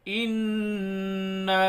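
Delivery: انا with the nun nasalised and the vowel held long; انا held long with ghunna; drawn-out nasal anna. Shown as a demonstration. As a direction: stretch انا slowly through the nose. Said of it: "اس کو ہم تھوڑا زیادہ کھینچیں گے".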